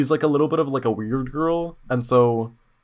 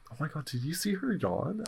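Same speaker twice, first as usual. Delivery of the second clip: hushed gossip; whispering; 3 semitones higher, 13 dB quieter than usual. Retold or imitated. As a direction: lean in and whisper it. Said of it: "was like".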